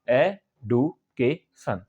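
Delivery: "Education" in four slow beats, ए-डु-के-शन; syllable by syllable. Shown as a demonstration. Teacher counting syllables for learners, "education" said slowly in four parts. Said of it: "चार बार में बोलते हैं".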